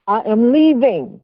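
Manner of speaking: "I am leaving" said slowly, each word weighted; retold firm refusal, drawing out "leaving"; slow, insistent "I am leaving"; emphatic insistence.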